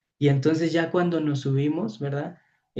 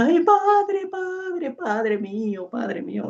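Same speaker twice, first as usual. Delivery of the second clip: high, drawn-out tearful whine, hammed up; mock wailing; 12 semitones higher, much slower than usual. Priest mimics someone bawling into the microphone at prayer, mock wailing. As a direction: imitate a crybaby pleading, high then sinking. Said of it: "hasta con el micrófono anda chillando".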